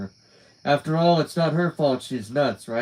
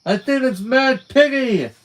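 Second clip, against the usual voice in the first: loud, high teasing cry; gleeful mock shout; 12 semitones higher, 7 dB louder than usual. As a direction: belt out the joke to tease. Teasing Juliet with piggy joke.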